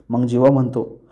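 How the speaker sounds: plain reading voice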